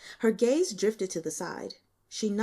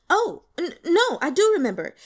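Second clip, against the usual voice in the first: high and loud, catching herself; sudden recollection; 10 semitones higher, 8 dB louder than usual. Acted. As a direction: correct yourself with a jolt of recall. Suddenly recalls mid-sentence.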